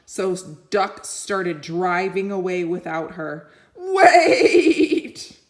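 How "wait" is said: high, loud plea; desperate cry. Acted. Sheep crying out as Duck drives off. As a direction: call out as the tractor leaves. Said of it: "cried Sheep".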